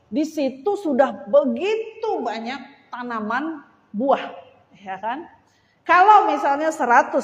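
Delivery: loud, high, rousing sermon delivery; fervent preaching. Acted. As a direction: preach it to the congregation with fire. Preaching at full force. (khotbah berapi-api dengan suara lantang)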